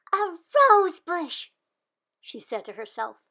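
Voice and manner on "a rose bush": high, slow, loud wonder; amazed. Acted. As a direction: breathe it out in awe. Girl discovers the bush.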